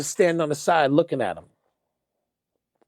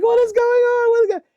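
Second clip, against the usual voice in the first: high, loud panicked shriek; imitated screaming; 8 dB louder than usual. Jennifer demands to know about the beating, imitated screaming. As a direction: scream the question, imitating shock. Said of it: "she starts screaming, like".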